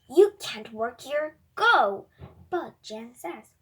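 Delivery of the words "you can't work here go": loud angry order, much louder. A narrator's reading. Chef Gordon throwing the robot out of his kitchen, loud and angry. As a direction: bark the order to leave, voice rising on go. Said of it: "Gordon is angry".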